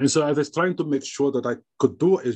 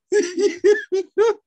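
laugh; 4 dB louder than usual. Real, not acted. Laughing mid-story.

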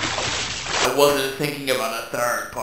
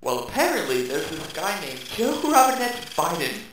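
ordinary talk, nothing marked

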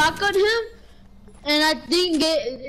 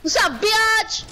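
cocky taunt; 7 semitones higher, slower than usual. Real, not acted. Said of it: "It's funny how you say it".

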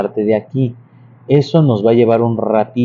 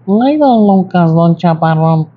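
much higher, slow nasal gossip voice; nasal Doña Chismes; 6 semitones higher, slower than usual. Retold or imitated. Teacher imitating Doña Chismes greeting Don Lucas. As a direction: whine it through the nose like the gossipy neighbour. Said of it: "la voz era nasal".

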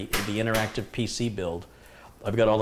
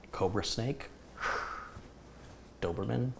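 ordinary talk

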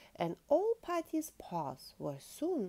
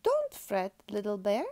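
puffin character; 2 semitones higher, 8 dB louder than usual. A narrator's reading. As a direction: cheer the cub on loudly. Puffin reassures the lost cub.

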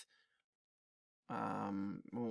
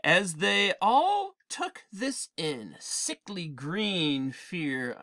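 very slow, hushed storytelling; dramatic narration; 4 semitones higher, 3 dB louder, much slower than usual. A narrator's reading. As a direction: let the dread sink in slowly.

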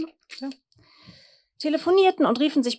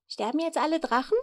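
mildly curious, higher-pitched asking; interested child's question; 5 semitones higher than usual. A narrator's reading. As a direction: ask with a touch of eager curiosity. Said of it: "fragte Fiete interessiert".

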